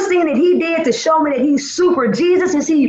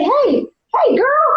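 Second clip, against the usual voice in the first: kind call; 11 semitones higher, slower than usual. Retold or imitated. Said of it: "He'll say".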